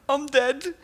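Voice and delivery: high gasp, then a doomed "I'm dead"; hammed-up panic, pitched high; mock terror. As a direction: gasp like the alien just found you. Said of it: "enough of a split second to go".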